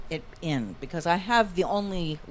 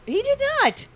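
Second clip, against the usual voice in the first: indignant denial; 5 dB louder than usual. Real, not acted. Denying the song request.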